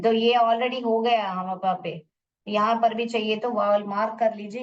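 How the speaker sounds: usual speaking voice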